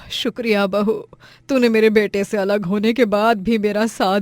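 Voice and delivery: loud, lightly voiced elder giving thanks; grateful mother-in-law. Mother-in-law accepting daughter-in-law as her daughter, grateful.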